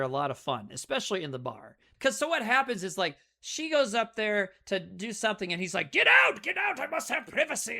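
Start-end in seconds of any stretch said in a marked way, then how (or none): 5.9-7.8 barked, high and loud, then dropping to a haughty tone; angry shout. Retold or imitated. Invisible man yelling at the landlady to get out. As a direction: throw her out, furious.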